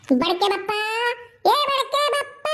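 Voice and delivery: long high yelling call; shouting out. Acted. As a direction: holler up to the old man on the platform. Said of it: "इतना कस कस के चिल्ला थे".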